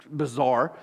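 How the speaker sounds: normal talking voice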